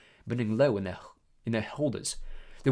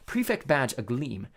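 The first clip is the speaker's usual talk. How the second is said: usual speaking voice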